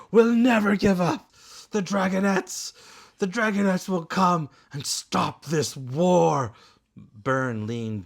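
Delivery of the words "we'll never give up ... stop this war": louder, higher, desperate defiant cry; cracking with despair. Acted. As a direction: shout defiance while breaking down. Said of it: "His voice cracked with despair".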